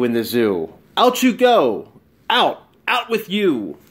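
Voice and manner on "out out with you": plain reading voice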